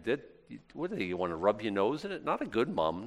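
ordinary delivery

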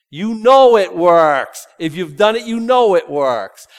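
loud, slow, hammered assurance; slow, emphatic shout of conviction; forceful preaching. Real, not acted. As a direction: pound home that it works. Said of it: "preaching emphasis".

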